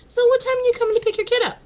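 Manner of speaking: high, loud, fast teasing question; teasing demand. Retold or imitated. To friend Lisa about fetching her kid, loud teasing. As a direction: fire it off like a pushy host. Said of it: "because I said to Lisa".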